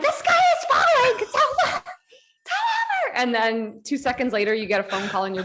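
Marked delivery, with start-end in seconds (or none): 0.0-3.2 very high, panicked squeal; hammed-up doom wail, stretching out at the end; Chicken Little. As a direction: cry out in comic terror. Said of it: "Yeah, Chicken Little".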